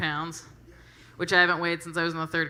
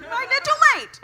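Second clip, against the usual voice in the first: mock guy voice; 9 dB louder than usual. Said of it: "And he was like".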